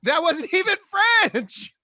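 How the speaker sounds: loud, high-pitched mock outrage; amused protest. Real, not acted. Defending a silly impression to a friend, amused protest. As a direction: protest loudly, voice jumping way up.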